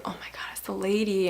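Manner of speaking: whispered alarm; whispering on seeing the dog owner. Retold or imitated. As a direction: breathe it out in a scared whisper. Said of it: "I was just like".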